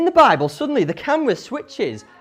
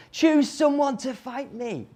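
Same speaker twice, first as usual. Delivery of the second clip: drawn-out, higher mock challenge; mildly voiced giant's challenge, slow and raised; shouted challenge; 5 semitones higher than usual. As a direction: call out a slow challenge without real shouting. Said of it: "he shouts his challenge".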